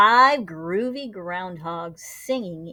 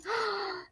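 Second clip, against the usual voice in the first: startled gasp; 11 semitones higher, 7 dB quieter than usual. A narrator's reading. Startled by their shadows.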